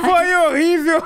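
high, laughing mock wail; mock whiny echo. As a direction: whine it back as a joke. Mocking the complaint, laughing.

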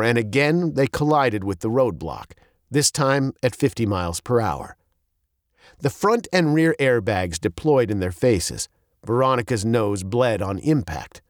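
ordinary delivery, nothing marked

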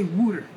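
"wooter" the Philadelphia way, first vowel like "woo"; Philadelphian "wooter". Shown as a demonstration. Showing Philly dialect to a friend, "wooter" with an oo vowel. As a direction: say "wooter" for water, with an oo in the first syllable. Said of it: "when people say the Philadelphians say".

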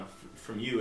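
usual speaking voice

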